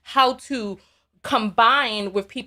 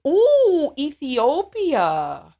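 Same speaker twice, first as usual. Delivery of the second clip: delighted; 4 semitones higher, much slower than usual. Real, not acted. Dreaming of visiting Ethiopia.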